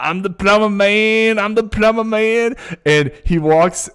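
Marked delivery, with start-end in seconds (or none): 0.0-2.6 plumber character. Retold or imitated. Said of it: "He's like".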